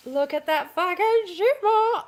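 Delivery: "Look at that fucking shimmer" stretched out, rising with glee; excited. Real, not acted. Showing viewers the dress fabric, slow delighted marvel.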